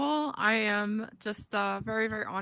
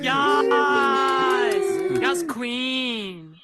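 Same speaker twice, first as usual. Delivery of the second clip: excited cheer; 4 semitones higher, 8 dB louder, much slower than usual. Real, not acted. Cheering a friend called to stage.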